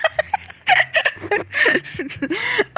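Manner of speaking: a long, climbing cackle of delight; high rising laugh. Real, not acted. Gamer cracks up at a friend's joke, high laugh. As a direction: let the laugh run up and up. Reacting to Sweet Manchego.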